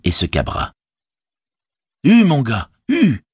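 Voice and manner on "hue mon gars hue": high, loud cry to a pony; urging shout. A narrator's reading. Sam urges on his rearing pony, shouting. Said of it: "cria-t-il".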